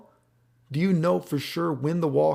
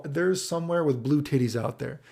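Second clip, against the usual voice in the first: normal talking voice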